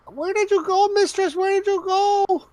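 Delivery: high, plaintive goblin voice; whiny goblin voice. Acted. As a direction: call out like a lost little goblin. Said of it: "Splug says".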